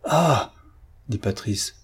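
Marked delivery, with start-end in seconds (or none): 0.0-0.5 higher-pitched angry outburst; shaken by anger. A narrator's reading. Patrice is refused an answer, shaken by anger. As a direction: let anger jolt out in one cry. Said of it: "secoué de colère".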